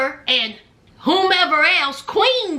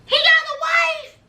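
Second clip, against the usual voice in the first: shocked disbelief; 10 semitones higher than usual. Retold or imitated. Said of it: "Their homegirl Queen said".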